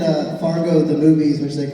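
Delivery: ordinary delivery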